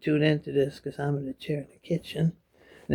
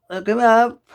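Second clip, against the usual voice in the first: louder, higher repeat; emphatic; 7 semitones higher, 6 dB louder than usual. Real, not acted. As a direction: push it the second time.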